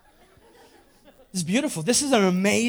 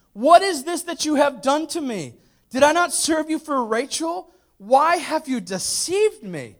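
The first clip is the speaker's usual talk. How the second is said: higher-pitched wronged groom; outraged protest; 6 semitones higher than usual. Acted. Jacob confronting Laban over the switched bride, mildly hammed-up outrage.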